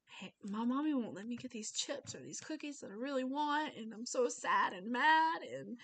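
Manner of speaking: mock little kid complaining; upset child. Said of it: "maybe they'll say".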